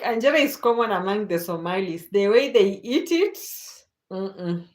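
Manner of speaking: ordinary talk, nothing marked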